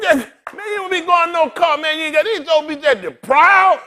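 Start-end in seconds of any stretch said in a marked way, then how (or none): none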